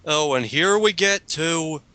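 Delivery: ordinary talk, nothing marked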